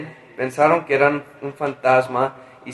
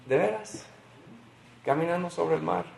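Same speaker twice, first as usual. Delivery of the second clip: much softer, hushed doubting question; softly asking "¿De veras?" in disbelief; quiet disbelief; 11 dB quieter than usual. Shown as a demonstration. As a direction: murmur it to yourself in wonder. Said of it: "siempre queda algo en mi corazón que dice".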